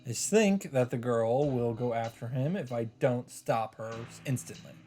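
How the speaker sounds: usual speaking voice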